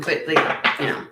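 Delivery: ordinary talk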